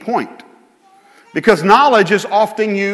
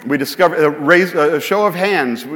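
ordinary talk

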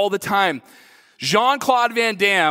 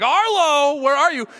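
frantic call; 8 semitones higher than usual. Retold or imitated. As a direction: call out for your missing son, alarmed. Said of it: "now I'm starting to freak out".

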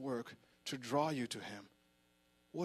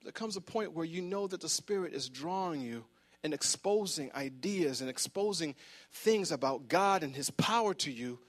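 ordinary talk

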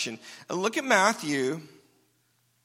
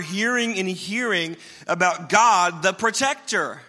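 louder, higher, driving sermon climax; full-force preaching; 4 semitones higher, 6 dB louder than usual. Acted. Pastor urging the congregation to inundate themselves, full-force preaching. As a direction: build it up and hammer it home.

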